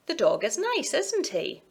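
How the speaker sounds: put-on "The dog is nice, isn't he" lifted high, as if to a toddler; child-directed question tag. Shown as a demonstration. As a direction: coax an answer with a high, lilting "isn't he".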